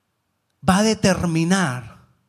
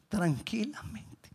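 slow, hushed, drawn-out word; unhurried soft murmur; calm hush; 2 semitones higher, 11 dB quieter, slower than usual. Acted. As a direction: stretch it softly, nearly whispered. Acting out Jesus's calm walk on water.

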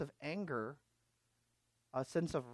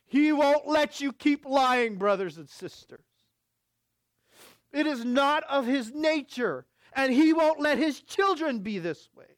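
preaching at full force; 11 semitones higher, 10 dB louder than usual. Acted. Preacher calling out to the church, loud and high. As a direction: drive it home to the congregation.

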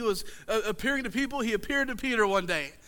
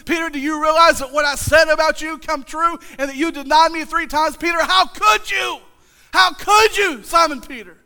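dreading scolding; 5 semitones higher, 7 dB louder than usual. Acted. Peter imagines Jesus scolding.

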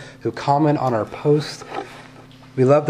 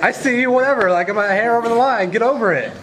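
silly clown; 9 semitones higher than usual. Retold or imitated. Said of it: "It's like".

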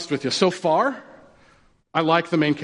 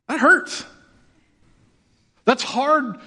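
high, pained groan of a complaint; mock pain; 9 semitones higher, much slower than usual. Real, not acted. Pastor joking to his congregation that the verse hurts, high mock pain. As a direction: play it like the verse stings, pitched up. Pastor reacting to hard teaching.

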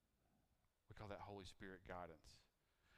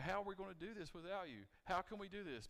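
worried disciples; 9 semitones higher, 4 dB louder than usual. Retold or imitated. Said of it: "They probably were thinking".

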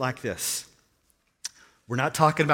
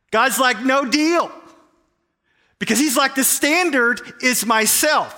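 raised, high-pitched pulpit insistence; forceful preaching; 6 semitones higher, 4 dB louder than usual. Real, not acted. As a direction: preach it with emphatic, righteous refusal.

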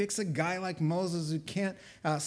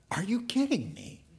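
higher-pitched mock disbelief; incredulous; 6 semitones higher than usual. Preacher scolds Gideon's complaint, incredulous. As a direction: throw it out in disbelief.

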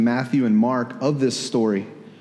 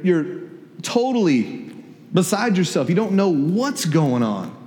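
normal talking voice

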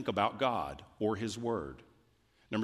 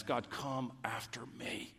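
soft whispered prayer; whispered plea; 9 dB quieter than usual. Acted. Pastor voicing the psalmist's prayer to God, whispered. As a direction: breathe it as an intimate plea.

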